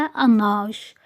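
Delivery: "أناج" said as French un âge, slowly; slow, careful French "أناج", ending in zh; French pronunciation. Shown as a demonstration. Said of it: "النطق بس اللي مختلف".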